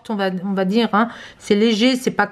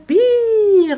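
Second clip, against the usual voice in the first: excited; 11 semitones higher, 5 dB louder than usual. Real, not acted. The secret message lights up.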